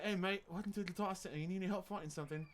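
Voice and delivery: fast, matey British greeting; British accent. Acted. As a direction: rattle it off in a put-on British accent. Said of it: "I can do a decent British accent".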